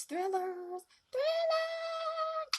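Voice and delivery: high, drawn-out thrillers, squealed with delight; repeated squeal of delight; excited. Real, not acted. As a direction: gush over the word thrillers. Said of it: "excited about thrillers".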